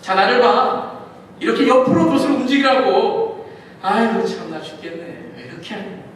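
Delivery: loud boastful demo, fading to a grumble; showing off. Acted. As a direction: show off your strength, then sigh in disgust. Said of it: "힘을 과시했을 수도".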